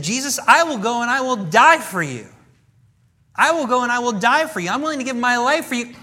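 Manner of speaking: loud, high, fervent vow; Peter's loud promise of loyalty; passionate Peter. Retold or imitated. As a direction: swear it boldly to Jesus. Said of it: "he said".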